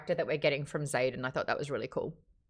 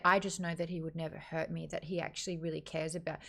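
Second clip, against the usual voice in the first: mock dreamy murmur; 3 semitones lower, 8 dB quieter, faster than usual. Heroine's inner voice mocked.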